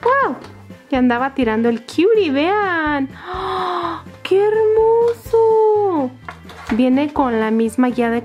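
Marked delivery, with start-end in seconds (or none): 0.0-0.4 very high delighted wow; excited wow. Real, not acted. Reveal of toy. 3.3-6.1 high, slow, drawn-out gush; delighted cry. Real, not acted. Reveal of snail toy.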